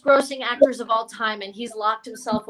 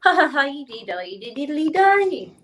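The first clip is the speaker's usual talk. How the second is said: giggly lilting Irish-geisha singsong; 3 semitones higher, 4 dB louder than usual. Shown as a demonstration. Comic shows the crowd her impression, Irish geisha voice. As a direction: trill nonsense syllables as a cartoon Irish geisha. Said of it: "Here's my impression of an Irish geisha".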